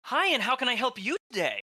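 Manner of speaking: high, chirpy register clerk; cashier voice. Retold or imitated. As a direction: rattle off the checkout script. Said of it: "flashbacks to running register".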